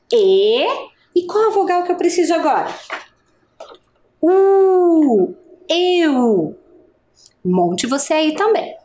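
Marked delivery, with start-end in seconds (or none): 0.1-6.6 teacher sounding out "E", "U", "Eu"; sounding out vowels. Shown as a demonstration. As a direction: build the word sound by sound. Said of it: "porque olha a pronúncia".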